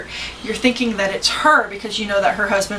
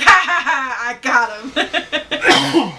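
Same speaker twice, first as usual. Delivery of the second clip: gloating laugh; 3 semitones higher, much slower than usual. Retold or imitated. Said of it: "he's like".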